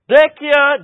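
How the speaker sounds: loud, high street cry; vendor's call. Retold or imitated. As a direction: call out for broken things. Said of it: "He's just saying".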